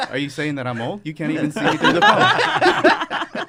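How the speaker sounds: laughing, high and loud; mock offended laugh. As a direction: squeal it out through laughter. Role play caller mock offended.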